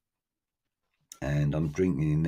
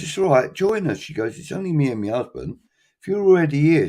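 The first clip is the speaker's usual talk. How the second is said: higher, louder cheery lady voice; friendly woman inviting; 9 semitones higher, 5 dB louder than usual. Retold or imitated. Woman in the pub inviting the teller to sit with her husband, higher cheery imitation. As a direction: lightly mimic a chatty woman waving him over.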